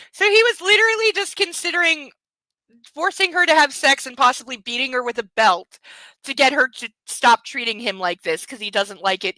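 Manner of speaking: normal talking voice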